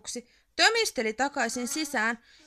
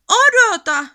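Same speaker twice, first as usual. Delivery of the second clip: much higher, loud desperate call; urgent shout; 12 semitones higher, 12 dB louder than usual. A narrator's reading. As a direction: shout after the friend. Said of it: "se huusi". (huutaa ystävän perään)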